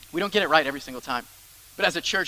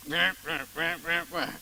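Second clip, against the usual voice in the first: soft, whispered rah rah grumble; wordless grumble; 5 dB quieter than usual. Retold or imitated. Servant grumbling at the Roman soldier, whispered grumble noises. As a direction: mutter angry nonsense under your breath.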